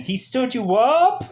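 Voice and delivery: high, drawn-out incredulous question; mock disbelief, pitch shooting up. Coworkers to Kathleen about her missed date, incredulous. Coworkers react to being stood up.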